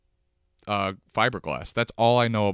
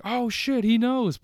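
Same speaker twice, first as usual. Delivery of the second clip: high, louder startled exclamation; mock astonishment; 4 dB louder, slower than usual. Latin folk reacting to him speaking Spanish, mock astonished. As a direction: imitate someone caught off guard, pitched way up.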